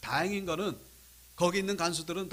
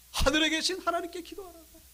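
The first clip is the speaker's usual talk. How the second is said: raised insistence; 8 semitones higher than usual. Retold or imitated. Recalled advice repeated with rising force.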